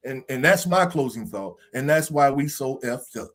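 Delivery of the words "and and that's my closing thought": usual speaking voice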